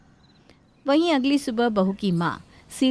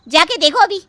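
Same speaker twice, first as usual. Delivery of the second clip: loud, higher, bossy; irritated order; 6 semitones higher, 9 dB louder than usual. Acted. Wife ordering husband to check his mother, loud. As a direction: send him off sharply.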